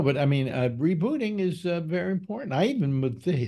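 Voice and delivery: ordinary talk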